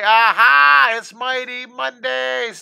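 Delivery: high, loud, slow Aha. Shown as a demonstration. Teacher leading kids in the class cheer, loud and slow. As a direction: shout it so the room comes running. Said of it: "Let me hear you say it really loud".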